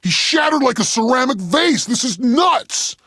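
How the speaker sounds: high-pitched, hammed-up horror; panicked commentary. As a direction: cry out the horror as you witness it. Horrified commentary on engineer's violent meltdown.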